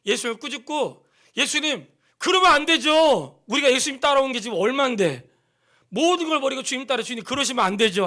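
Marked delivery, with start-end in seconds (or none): 1.4-8.0 loud, high pleading rebuke of the Lord; Peter's protest. Acted. Preacher voicing Peter scolding Jesus, loud protest. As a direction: act out Peter scolding Jesus, raised and insistent.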